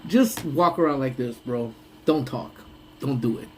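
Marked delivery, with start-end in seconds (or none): none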